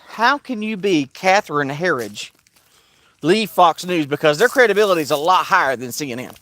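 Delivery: normal talking voice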